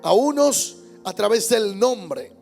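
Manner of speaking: ordinary delivery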